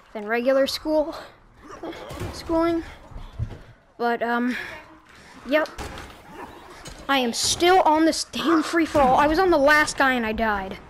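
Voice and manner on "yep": normal talking voice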